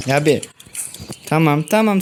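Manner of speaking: ordinary delivery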